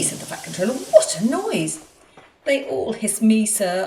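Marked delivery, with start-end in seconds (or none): 0.7-1.8 much higher and louder, slow and stretched; startled outcry. A narrator's reading. Fat Controller startled by the hissing, exclaiming loudly. 2.4-3.8 sadly. A narrator's reading. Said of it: "answered Edward sadly".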